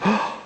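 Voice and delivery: soft, high gasped "oh"; astonished gasp. Retold or imitated. Woman moved the leg and it stopped hurting, astonished gasp. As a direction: imitate a sudden intake of amazed breath.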